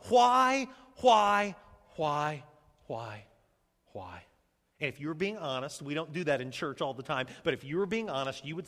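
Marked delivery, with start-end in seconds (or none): 0.1-1.5 loud, insistent WHY, higher and forceful; loud anguished "why"; crying out. Acted. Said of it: "Maybe you've cried out". 2.9-4.2 WHY sunk low and soft; low, spent "why"; despairing fade. Acted. Cry to God dying away.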